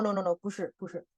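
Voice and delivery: ordinary talk, nothing marked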